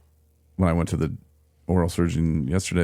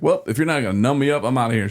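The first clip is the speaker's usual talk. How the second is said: higher, louder, quick ultimatum; defiant raised voice; 7 semitones higher, 3 dB louder, faster than usual. Retold or imitated. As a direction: announce you're leaving. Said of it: "I'm like".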